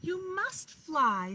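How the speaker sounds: high, loud, slow urging; mother owl urging. A narrator's reading. Mother owl urging her last chick, high and slow. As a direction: stretch it out like a coaxing mother. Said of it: "said her mother".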